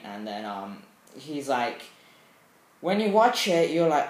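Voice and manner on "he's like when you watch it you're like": usual speaking voice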